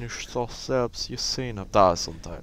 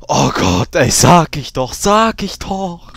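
loud, high, rattled exclaim; shaken and loud; 5 semitones higher, 11 dB louder, slower than usual. Real, not acted. Gamer just scared by zombie, loud rattled outburst. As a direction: still shaking from the fright.

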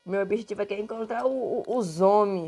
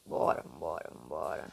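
deep put-on coaxing; 7 dB quieter than usual.

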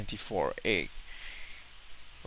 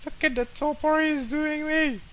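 very high frantic complaint; laugh-squeal in disbelief; real panic breaking through; panicked laughing; 12 semitones higher than usual. Real, not acted. Panicking as torpor climbs.

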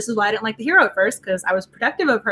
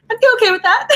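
laughing; 11 semitones higher, 4 dB louder, faster than usual. Real, not acted. Laughing while agreeing.

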